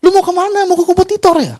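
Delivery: high, probing boss questioning a resignation; boss asking. Retold or imitated. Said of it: "Dia tanya".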